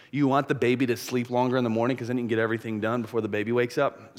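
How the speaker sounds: normal talking voice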